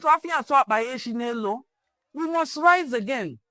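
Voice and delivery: ordinary talk, nothing marked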